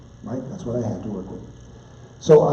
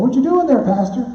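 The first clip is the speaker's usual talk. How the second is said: church member calling out; 10 semitones higher, 3 dB louder than usual. Retold or imitated. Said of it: "looks at me, and goes".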